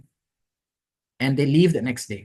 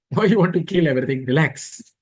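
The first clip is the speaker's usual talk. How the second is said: louder, quick laughing plea; laughing tease; 3 semitones higher, 5 dB louder than usual. Retold or imitated. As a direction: laugh him out of his rage. Ram teasing angry Lakshman.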